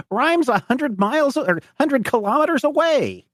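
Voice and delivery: put-on very high-pitched old farmer; high, incredulous farmer voice; amused old farmer. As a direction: scoff at a lost stranger in a high voice. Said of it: "he starts laughing".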